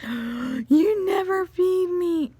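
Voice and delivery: high, loud, drawn-out wail; dramatic kid. Retold or imitated. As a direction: imitate a kid's wounded complaint. Imitating upset daughter's complaint.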